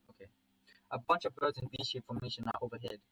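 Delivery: ordinary delivery, nothing marked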